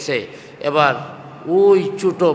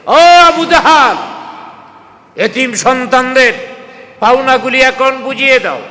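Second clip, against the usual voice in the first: loud, high-pitched summons; calling out; 9 semitones higher, 7 dB louder than usual. Retold or imitated. Prophet at Abu Jahl's door demanding the orphans' dues, calling out. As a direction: call him out forcefully in raised pitch. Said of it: "দরওয়াজার সামনে দাঁড়ায় ডাক দিছে".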